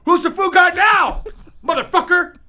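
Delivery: triumphant gloating. Real, not acted. Gloating after winning food quiz.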